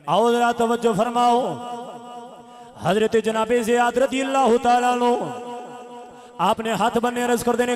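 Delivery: loud, ringing sermon chant; full-force preaching. A narrator's reading. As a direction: preach the battle story at full volume. Preaches the battle story.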